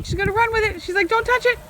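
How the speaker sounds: rising high over the playful dog; excited high pet talk. Real, not acted.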